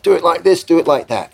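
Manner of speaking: mock a producer parroting someone else's orders. Producer relays the visitor's directions to the band, parroting. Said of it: "The producer's like".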